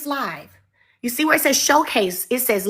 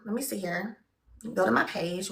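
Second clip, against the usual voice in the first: soft, lower under-breath talk; muttering to herself; 3 semitones lower, 9 dB quieter than usual. Real, not acted. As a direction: mumble while searching the phone. Muttering while fiddling with phone.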